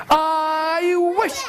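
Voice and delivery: long vowel swooping upward; building wish. Acted. Wife making a magic wish, drawn-out rising swoop. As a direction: slide up high and burst onto the last word.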